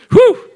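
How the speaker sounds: high, loud whoop of a whew; sudden high burst of exhilaration; excited whew. Real, not acted. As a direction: let out a big "whew". Exclaims after the promise.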